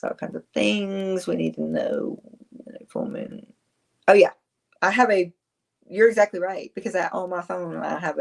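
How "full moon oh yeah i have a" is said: usual speaking voice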